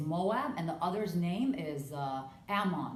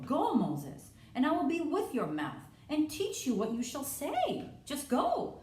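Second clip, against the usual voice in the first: higher and higher, pressing God's command; insistent God; 8 semitones higher than usual. Acted. God urging Moses to just go, rising commanding voice. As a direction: push him out the door, rising high.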